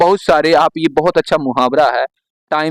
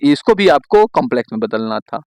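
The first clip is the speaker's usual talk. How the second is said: ordinary talk, nothing marked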